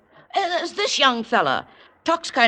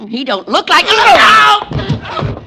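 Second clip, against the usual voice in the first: loud, drawn-out yell after him; startled shout; 8 semitones higher, 12 dB louder than usual. Acted. Landlady yelling as the suspect knocks the cop down and runs. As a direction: bellow it out as he bolts.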